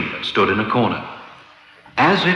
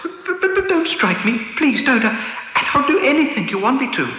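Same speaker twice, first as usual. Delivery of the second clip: high, pleading and stammering; beg, stammering in fear; trembling voice. A narrator's reading. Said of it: "in a trembling voice".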